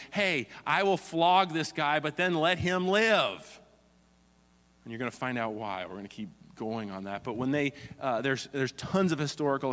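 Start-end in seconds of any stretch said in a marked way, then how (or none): 0.1-3.6 Pilate pleading. Retold or imitated. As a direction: coax the crowd into a deal.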